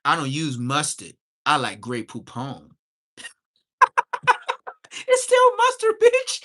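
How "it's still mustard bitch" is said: very high, loud squealing retort; high-pitched mocking yell; mock shriek. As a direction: shriek it back at him.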